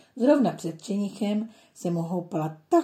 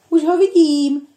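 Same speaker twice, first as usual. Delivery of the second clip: high, loud triumphant cry; a boy exclaiming he found the puppy; excited shout; 9 semitones higher, 13 dB louder than usual. A narrator's reading. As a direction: shout it out on spotting him. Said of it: "vykřikl Martínek".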